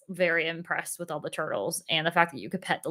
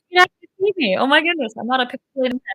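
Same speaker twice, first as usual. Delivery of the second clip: excited; 6 semitones higher, 7 dB louder, faster than usual. Real, not acted. Excited greeting on fixed stream.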